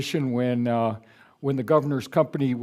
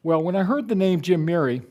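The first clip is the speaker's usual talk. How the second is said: ordinary talk, nothing marked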